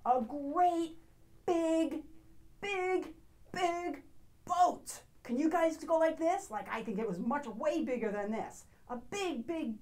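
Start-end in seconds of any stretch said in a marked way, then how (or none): 0.1-5.0 rising excitement. Acted. Describing a huge boat.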